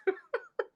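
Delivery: short high chuckle; amused laugh. Real, not acted. Preacher reacting to unpaid bills, amused laugh. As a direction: let out a light, high laugh.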